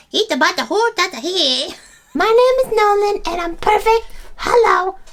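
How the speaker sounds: very high, slow, loud cooing; high sing-song babble for her son. Real, not acted. Mom coos to baby.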